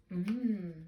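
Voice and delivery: ordinary talk, nothing marked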